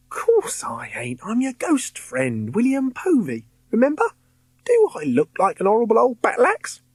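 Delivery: put-on lively high Cockney boy's voice; chirpy London lad, cheeky; Cockney ghost boy.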